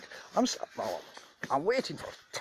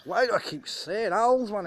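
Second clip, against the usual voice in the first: exasperated outburst; 9 semitones higher, 7 dB louder than usual. Real, not acted. Repeats question, then bursts.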